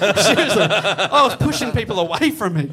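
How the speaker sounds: loud laughing boast. Real, not acted.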